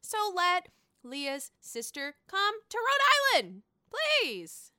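high, slow whiny plea; mock pleading. Imitating Leah begging to bring her sister, high and whining. Voicing Leah's pleading request.

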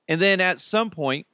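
ordinary talk

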